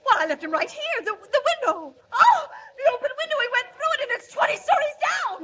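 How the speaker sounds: high, loud frightened cries; panicked shriek. Acted. Secretary finds the open window, high and frightened. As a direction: gasp it out in horror at the window.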